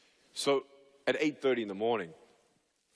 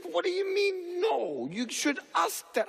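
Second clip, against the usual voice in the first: exasperated brother. Retold or imitated. Brother scolds him in the car.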